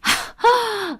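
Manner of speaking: high scornful scoff of a laugh; one contemptuous laugh. Acted. Mother-in-law scoffing at her daughter-in-law, high scornful laugh.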